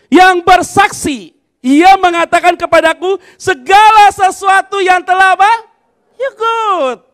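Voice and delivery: loud, slow scripture reading led from the pulpit; loud group reading. Acted. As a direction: lead the whole church reading scripture aloud. Leading congregation reading aloud.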